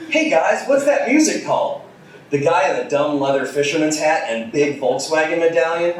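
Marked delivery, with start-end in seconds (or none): 0.1-1.8 put-on naive high girl. Diane asks trio.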